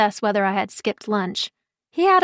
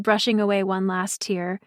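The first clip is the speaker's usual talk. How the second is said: ordinary delivery, nothing marked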